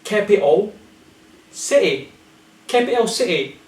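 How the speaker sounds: "Capital city" as "capi'o si'ee"; glottal T. Shown as a demonstration. Teacher leading repetition, "capital city" with glottal stops. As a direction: drop the Ts of "capital city" into the throat. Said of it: "say it with me".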